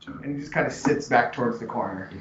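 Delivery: usual speaking voice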